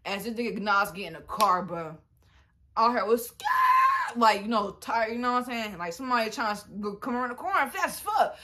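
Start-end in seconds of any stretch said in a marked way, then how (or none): 3.3-4.2 sharp high yelled "Scat!"; a loud startled burst; mock gunshot shout. Gunshot-like shout in retold chase.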